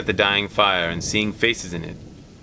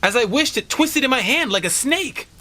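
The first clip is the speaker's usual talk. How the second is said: high, uneasy telling of the shock; father telling his family what the paw did, shaken and high; shaken old man; 13 semitones higher than usual. Acted. A touch of fright in the voice.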